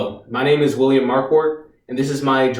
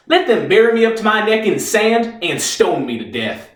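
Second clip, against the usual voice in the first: higher-pitched, grand martyr's speech; defiant declaiming; 4 semitones higher than usual. Acted. Reeves performs his act for the lawyer, defiantly declaimed.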